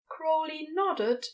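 plain reading voice

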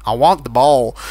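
low, mock American accent; imitated American accent. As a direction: play the villain with an American accent. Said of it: "He's an American accent".

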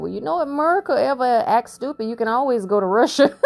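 high-pitched teasing quote of a friend; imitating my guy. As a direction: lift the voice high for the friend's line. Acting out guy's quoted remark.

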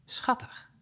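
soft breathy aside, almost no voice; a whispered little coo over the chicks. Real, not acted. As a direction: whisper it fondly to yourself. Reader's aside on cute chicks.

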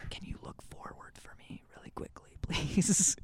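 hairdresser ASMR whisper. Pretend. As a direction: whisper like a gentle barber.

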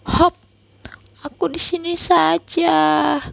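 put-on high small child voice; a little boy settling in somewhere safe. Scared boy climbing somewhere dry, small high voice.

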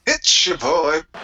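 high, slow catchphrase. Acted. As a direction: sing out the catchphrase. Catchphrase in unison.

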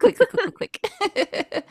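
high, badly faked alien clicks; bad Predator clicking. Host trying the Predator's extraterrestrial clicks, badly faked high clicking. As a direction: attempt the monster's clicking sound and fail. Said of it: "I can't do that sound effect at all".

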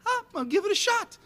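high-pitched voice for a dog; light, high cartoonish aside; dog's thought. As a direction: play a dog chancing it. Voices the dog deciding to bolt.

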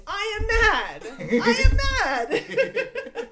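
loud, slow, laughing yell of I am mad; hammed-up angry shout; mock mad yelling. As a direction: shout it out while laughing at yourself. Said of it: "stomping around my empty apartment saying".

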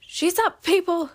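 high, loud call to the others; yelled announcement. A narrator's reading. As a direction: call it out to a crowd. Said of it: "a female yelled".

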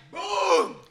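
long drawn-out "boom"; booming crash noise made by mouth; imitated impact boom. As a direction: sound out the thud of a body hitting. Imitating the chest hitting the railing.

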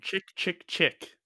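"chick, chick, chick" said as clicky syllables, slow and careful; saying the band name. Shown as a demonstration. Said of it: "It's my best interpretation".

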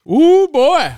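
high, loud whoop at the burning heat. Real, not acted. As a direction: let out a big whoop. Reacting to spicy heat.

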